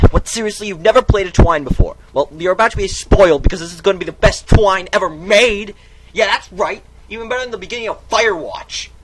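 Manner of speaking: loud, high, indignant yelling; outraged rant. Acted. Narrator shouts at a player who never played Twine, outraged. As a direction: rant at them like they've insulted you.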